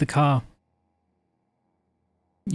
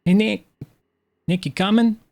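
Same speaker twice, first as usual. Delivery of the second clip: raised, higher call to someone outside; yelling out; 7 semitones higher, 4 dB louder than usual. Acted. Driver hailing Nicholas on the roadside, yelling out. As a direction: call out to catch a friend's attention. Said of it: "and yells out".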